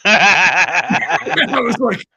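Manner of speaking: real laughter spilling over the words; laughing. Real, not acted. Storyteller cracking up at his own story, loud laugh. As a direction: laugh loudly through it. Laughing while retelling the punchline.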